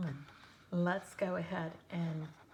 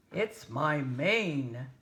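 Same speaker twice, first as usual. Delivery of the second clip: slow, aggrieved lion; 4 semitones lower, 7 dB louder, much slower than usual. Acted. Lion complaining, low and slow.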